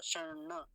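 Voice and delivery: शर्ण as shaṇ-ṇa, first n lightly touched; touch the retroflex n, then release na in शर्ण; light half nasal. Shown as a demonstration. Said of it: "हल्का सा ही आएगा".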